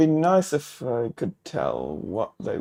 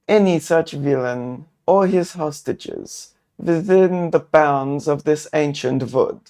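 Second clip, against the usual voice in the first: louder, higher measured cultist voice; gentle robed elder telling the traveler what he knows; more gently; 4 semitones higher, 5 dB louder than usual. Acted. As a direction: answer calmly like a wise elder. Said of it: "this one a little more gently".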